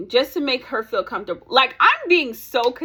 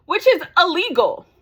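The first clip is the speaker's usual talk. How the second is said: high, loud, outraged verdict; indignant emphasis; 7 semitones higher, 6 dB louder than usual. Real, not acted. Vlogger telling viewers of withheld pictures, indignant emphasis. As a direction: punch it out higher and louder.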